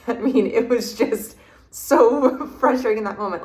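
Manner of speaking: a real rueful laugh breaking into the line. Real, not acted. Recalling the frustrating ceremony to the interviewer, laughing.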